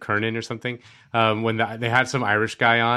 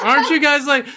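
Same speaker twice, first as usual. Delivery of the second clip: high, loud, laughing through it; hammed-up squeaky confusion; mock clueless host; 7 dB louder than usual. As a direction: squeak out the question in disbelief. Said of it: "he's like".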